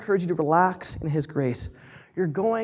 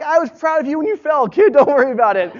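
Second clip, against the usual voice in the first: loud, higher-pitched burst of fatherly pride; proud dad cheering; 8 semitones higher, 10 dB louder than usual. Retold or imitated. Dad to his son after the hammock climb, proud and loud. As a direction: call it out high and loud to your kid.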